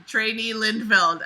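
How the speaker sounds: loud, slow drill instructor call; instructor's command. Acted. Instructor addressing a trainee, loud drawn-out command. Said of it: "the T I commanded".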